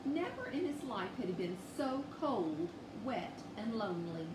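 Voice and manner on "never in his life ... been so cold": usual speaking voice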